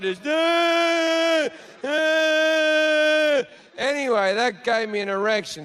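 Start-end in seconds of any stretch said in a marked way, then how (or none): 0.3-3.4 high, loud imitated wailing; hammed-up high sobbing wail; mock crying wail. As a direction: wail over the hairdryer noise, much higher. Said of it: "she's crying, she's holding a hairdryer".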